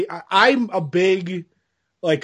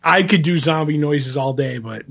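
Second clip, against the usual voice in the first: ordinary talk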